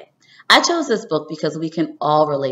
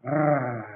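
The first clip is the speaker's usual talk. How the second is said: shivering brrr; 5 dB quieter than usual. A narrator's reading. Landing in cold mud.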